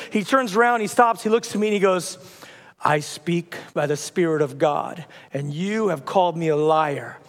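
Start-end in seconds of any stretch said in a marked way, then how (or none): 2.8-7.2 low, slow pronouncement; measured, ominous lowered voice; solemn prophet. Acted. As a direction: speak gravely as God's messenger.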